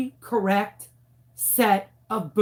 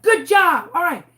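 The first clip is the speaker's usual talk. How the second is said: upbeat praise; 7 semitones higher, 5 dB louder than usual. Retold or imitated. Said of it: "I'm like".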